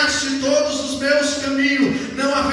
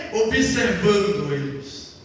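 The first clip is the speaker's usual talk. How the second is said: low and slow, weighty; booming male voice; 6 semitones lower, slower than usual. Acted. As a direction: drop the voice deep and slow. Dramatic pause on God watching.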